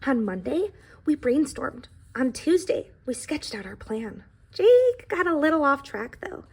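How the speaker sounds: storybook read-aloud voice; reading to children. A narrator's reading. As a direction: tell the pie's story to a classroom. Reads the book aloud.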